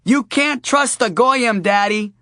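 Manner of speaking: put-on high, slow little girl warning; a child earnestly confiding. Daughter warning her dad on the phone, slow little girl voice.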